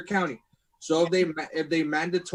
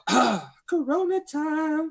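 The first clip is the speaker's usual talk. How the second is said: slow, higher commercial voice; ad announcer; 10 semitones higher, slower than usual. Retold or imitated. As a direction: drawl it like a beer ad. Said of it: "and I'll go".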